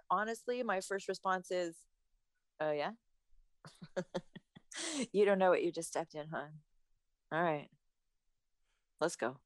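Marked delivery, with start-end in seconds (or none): 2.6-9.4 lightly acted low, cool comeback; mock cocky challenge. Podcaster acting out a reply to a texter, low mock challenge. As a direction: lower your voice for a mock challenge.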